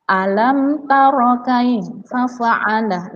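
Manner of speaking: "taro" with a short ro, slowly recited; correct short reading. Shown as a demonstration. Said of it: "seharusnya dibaca pendek, bukan panjang".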